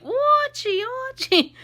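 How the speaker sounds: put-on high, loud squawking TV-wife accent; Edith Bunker. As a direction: call out shrilly in a hammed-up sitcom accent. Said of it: "first time I did that accent".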